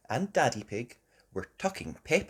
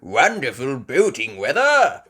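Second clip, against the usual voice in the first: put-on louder, hearty old grandpa; jolly grandpa; 2 semitones higher, 11 dB louder than usual. A narrator's reading. Grandpa Pig on his boat, louder hearty voice. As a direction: boom it cheerfully like a sailor. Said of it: "Grandpa Pig said".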